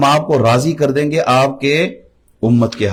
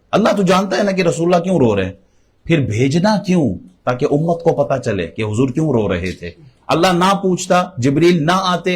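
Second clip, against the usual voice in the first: high, swooping rhetorical delivery; impassioned preaching; 5 semitones higher than usual. Real, not acted. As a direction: drive the rhetorical points home with raised pitch. Preaches rhetorical questions, pitch raised. (اونچے سُر میں پُرجوش خطابت)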